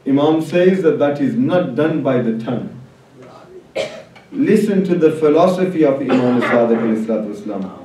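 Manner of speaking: ordinary talk, nothing marked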